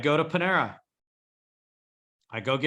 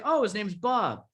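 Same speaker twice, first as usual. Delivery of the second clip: Brenda's reply; 5 semitones higher than usual. Retold or imitated. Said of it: "she'd say".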